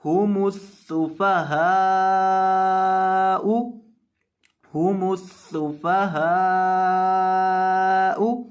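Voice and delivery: हुमुस सुफहाउ drawn out slowly; slow, drawn-out Quran reading; slow recitation. Shown as a demonstration. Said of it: "recitation demo".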